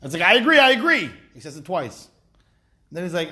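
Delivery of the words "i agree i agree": high, loud eager agreement; over-hasty agreement. Acted. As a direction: blurt it out twice, too quickly. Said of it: "it was too quick to say it".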